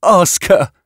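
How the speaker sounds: high, insistent demand; heated insistence. A narrator's reading. Scientist demands her colleague question the woman, heated and insistent. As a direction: press the point, pitched high. Yatena keeps arguing angrily with Brax.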